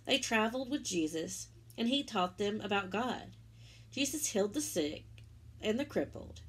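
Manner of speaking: gentle storybook narration; reading to children. Acted. Teacher reading the Easter story to children, storybook voice. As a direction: tell it warmly to little ones.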